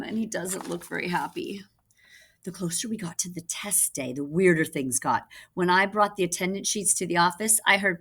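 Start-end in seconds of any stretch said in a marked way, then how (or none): none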